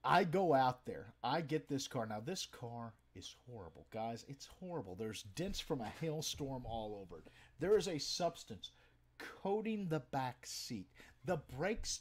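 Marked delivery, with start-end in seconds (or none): none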